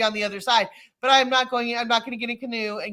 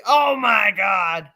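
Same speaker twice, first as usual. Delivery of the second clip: excited outburst; 5 dB louder, much slower than usual. Real, not acted. Excited reaction to geocaching.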